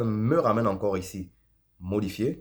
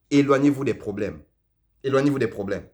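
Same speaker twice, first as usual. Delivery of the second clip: louder, higher on "Éloignez-vous"; emphatic warning; 5 semitones higher, 6 dB louder than usual. Real, not acted. Tutorial host warning viewers off legal trouble, emphatic. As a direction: hit "Éloignez-vous" high and hard, then come down.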